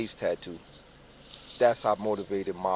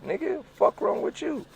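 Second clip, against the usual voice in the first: squeaky outrage. Retold or imitated. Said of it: "You start getting offensive".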